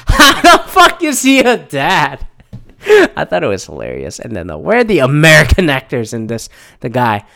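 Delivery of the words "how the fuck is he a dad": higher, slow, laughing through the words; laughing disbelief. Real, not acted. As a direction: crack up while asking it. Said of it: "and I started losing it".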